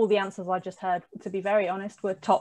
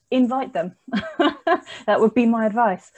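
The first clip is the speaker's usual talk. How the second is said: amused, louder, breaking into a laugh; 4 semitones higher, 6 dB louder than usual. Real, not acted. Panelist giving blunt advice to the audience, laughing. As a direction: land the blunt point, then laugh.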